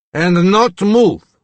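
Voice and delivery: slow, loud, high-pitched order; drawn-out warning in character; stern command. A narrator's reading. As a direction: order it forcefully, word by word. Regan orders doctor to stay put.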